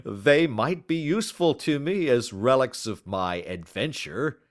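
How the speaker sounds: words spilling through laughter; laughing. Acted. Baker amused by the relics offer, laughing. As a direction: still laughing as he speaks. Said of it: "burst into a hearty laugh".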